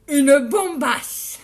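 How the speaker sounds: loud, drawn-out mock German accent. Streamer boasting how she will look, hammed-up German accent. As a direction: boom it slowly in fake German. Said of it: "Je fais l'accent allemand".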